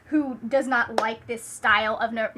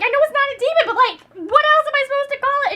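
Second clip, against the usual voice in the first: much higher and louder, mock panic; panicked vicar; 12 semitones higher, 8 dB louder than usual. Vicar reacting to the dragon egg hatching, hammed-up panic. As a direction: shriek it like the egg hatched a monster. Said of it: "And he's like".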